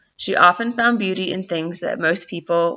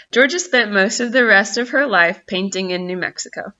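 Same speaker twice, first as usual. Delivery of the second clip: plain reading voice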